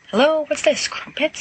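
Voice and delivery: high, bright-eyed surprise at the door; surprised Sirius. Acted. Sirius barging into tea, high and surprised. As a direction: burst in high and delighted at the crumpets.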